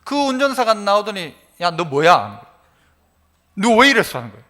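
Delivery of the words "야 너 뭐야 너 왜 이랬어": mock-stern, punchy challenge; retold scolding. Driver scolding the child who marked the car, retold scolding. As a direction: imitate a driver telling off a child. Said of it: "막 야단을 치니까".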